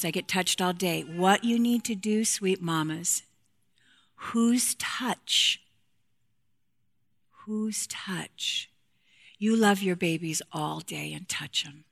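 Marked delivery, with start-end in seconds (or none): 4.1-8.7 whispered question, drawn out. Real, not acted. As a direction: breathe the question to them. Rhetorical question to mothers.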